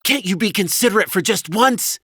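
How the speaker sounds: high, loud exasperated wife; loud, high-pitched reproach; flaring anger. A narrator's reading. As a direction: scold angrily in a high voice. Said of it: "her anger flaring".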